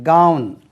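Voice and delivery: கவுன் loud, one syllable, "gaun"; emphatic English கவுன், rhyming with "town"; correct pronunciation. Shown as a demonstration. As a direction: model கவுன் with a gliding au vowel. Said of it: "கவன் சொல்லுவோம்".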